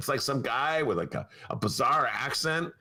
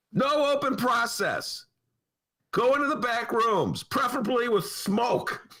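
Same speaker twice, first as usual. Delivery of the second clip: fired-up rant; 9 semitones higher than usual. Real, not acted. Host lecturing party leaders on redistricting, fired-up rant. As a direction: hammer home the back-room advice.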